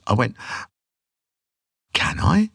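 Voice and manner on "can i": high, whispery, incredulous question; retold surprise. Bongo player asked to sing, hushed surprise. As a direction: ask it in hushed, high disbelief.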